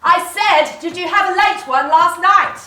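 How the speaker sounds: loud impatient repeat. Acted. Repeating louder to drunk man.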